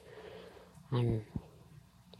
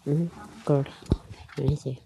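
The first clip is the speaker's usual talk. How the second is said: usual speaking voice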